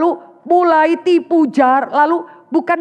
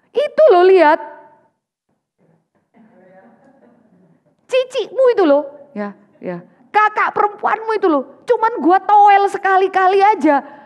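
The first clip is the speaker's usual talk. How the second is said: higher, louder mock rough retort; rough husband reply; 6 semitones higher, 4 dB louder than usual. Husband blaming the fertile sister, hammed-up rough retort. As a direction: snap back defensively. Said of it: "Yakub itu bisa jawab yang kasar loh".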